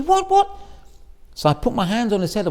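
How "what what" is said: much higher, louder startled question. Retold or imitated. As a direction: jump in alarm. Rebbe reacting startled.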